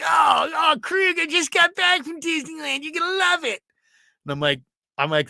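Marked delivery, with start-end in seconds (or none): 0.0-3.6 high, shouted gushing voice; excited Ralph. Retold or imitated. Coworker hearing about Disneyland trip, high excited gushing. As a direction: gush about Disneyland, pitched way up. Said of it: "He's like".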